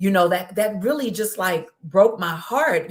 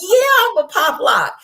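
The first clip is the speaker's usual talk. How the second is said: joyful shout; 7 dB louder than usual. Real, not acted. Celebrating that she can move.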